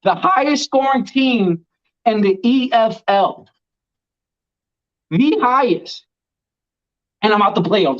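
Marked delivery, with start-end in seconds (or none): 0.0-6.1 slow, proud and emphatic, higher; proud announcement. Real, not acted. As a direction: savour each word, boasting. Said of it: "proud to say this".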